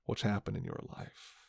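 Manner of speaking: ordinary talk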